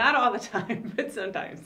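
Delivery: real chuckle breaking into the words; amused. Real, not acted. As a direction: say it through a real laugh. Joking aside about human fallibility.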